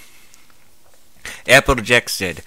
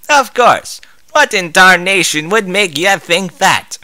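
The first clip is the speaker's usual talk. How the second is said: loud Southern cowgirl voice; 9 semitones higher, 4 dB louder than usual. Acted. Applejack voiced.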